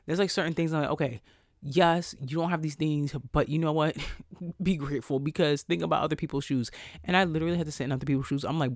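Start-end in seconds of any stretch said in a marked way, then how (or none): none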